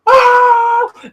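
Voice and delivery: very high loud yelled ah; crowd roar. Retold or imitated. The crowd erupting at the punchline, with a high yelled roar. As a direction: roar like the arena going mad.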